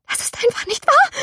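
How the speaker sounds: high, shaky pleading; panicked denial. A narrator's reading. Girl tells herself it isn't real, high panicked denial. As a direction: deny it in rising panic.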